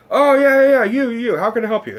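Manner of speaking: loud, high burst as host; excited recognition. Retold or imitated. Host spots the fan in the balcony, excited recognition. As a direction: spot them and call out eagerly.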